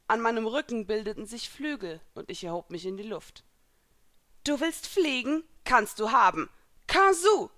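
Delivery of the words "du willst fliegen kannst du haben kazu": challenging shout, rising to a cry; called out. A narrator's reading. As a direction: answer a rival, building to a loud battle call. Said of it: "rief Elsa".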